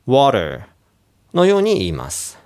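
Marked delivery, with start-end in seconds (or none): 0.1-0.6 American "water", T turned to a quick tap, R-coloured ending; American pronunciation. Shown as a demonstration. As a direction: flick the T lightly in "water". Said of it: "アメリカとかの発音だと".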